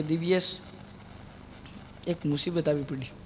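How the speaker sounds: low, soft mournful friend; depressed friend moaning about a problem; gloomy lament. Retold or imitated. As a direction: sigh it out like a man in trouble. Gloomy friend, head in hand.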